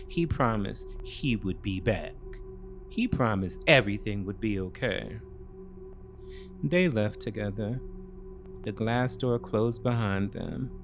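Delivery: normal talking voice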